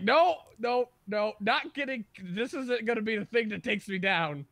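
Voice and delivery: high, loud mock-panicked refusal; retold panic. Telling friends about fleeing the school computer, retold panic. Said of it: "I was like".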